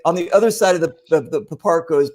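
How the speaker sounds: ordinary talk, nothing marked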